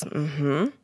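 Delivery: soft, low, knowing hum of assent; client's quiet acknowledgement, mildly imitated; imitating a quiet mm-hmm. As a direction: mimic her hushed agreement. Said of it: "Like this".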